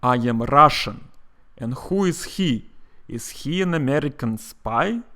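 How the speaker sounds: hammed-up Cold War Russian accent; movie Russian accent. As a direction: play a film Russian, rolled R and throaty H. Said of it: "representing Russians spoke like this".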